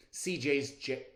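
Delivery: plain reading voice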